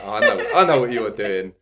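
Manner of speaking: amused aside to himself; chuckling knowingly. Retold or imitated. Knowing chuckle at the client's cover-up, said through laughter. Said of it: "I'm laughing to myself, I'm chuckling".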